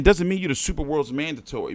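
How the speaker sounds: usual speaking voice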